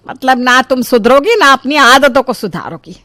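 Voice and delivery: lightly voiced, fed-up elder; exasperated mother-in-law. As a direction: scold as if giving up. Mother-in-law gives up, scolding.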